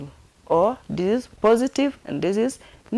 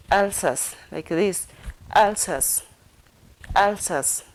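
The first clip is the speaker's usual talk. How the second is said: ordinary talk, nothing marked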